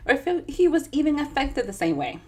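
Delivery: ordinary delivery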